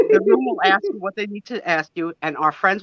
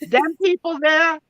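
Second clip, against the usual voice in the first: put-on Southern accent; 6 semitones higher than usual. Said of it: "use a Southern accent when I say it".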